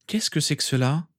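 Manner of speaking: high, anxious question; worried. A narrator's reading. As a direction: ask it with unease. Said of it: "demanda-t-il inquiet".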